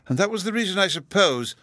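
high-pitched dignitary's voice; high character voice for a grand official; Lord Chancellor. A narrator's reading. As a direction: suggest it to a small child from on high in pitch. Lord Chancellor addresses small child.

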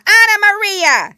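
very high, very loud squeal of a rat; a rat shrieking the name out; twitchy squeaking rat voice. Acted. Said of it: "squeaked the rat".

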